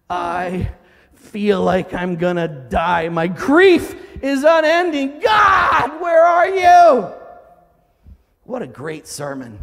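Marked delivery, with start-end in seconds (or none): 0.1-7.1 slow build into a high, loud shout; acted despair, swelling to full-voiced wail; anguished cry. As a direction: cry out to God, rising to a drawn-out scream. Acting out psalmist crying to God.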